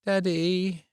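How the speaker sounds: put-on high, drawn-out child's groan; a sleepy kid groaning for her dad; groaning little girl. A narrator's reading. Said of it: "Abby groaned".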